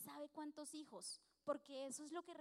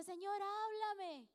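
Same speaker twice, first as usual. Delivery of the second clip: slow, higher and louder, Señor drawn out like a plea; mock pleading prayer; 6 semitones higher, 4 dB louder, much slower than usual. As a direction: pray it like a churchgoer begging to be spoken to. Imitating a pleading believer's prayer.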